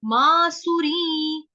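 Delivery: मासुरी drawn out slow, sung; anusvara demo. Shown as a demonstration. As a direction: sing मासुरी slowly, linking the म. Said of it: "अनुस्वार है उसका उच्चारण नी". (मासुरी धीरे गाकर)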